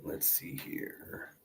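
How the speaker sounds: soft unvoiced whisper to self. Real, not acted. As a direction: whisper it to yourself. Host muttering to himself.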